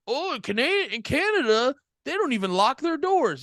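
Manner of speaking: high-pitched mocking impression; mock Michael Moore. Co-host mocks a filmmaker praising Canada, high mock amazement. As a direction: push the voice way up, parodying naive amazement. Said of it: "Michael Moore movie where he's like".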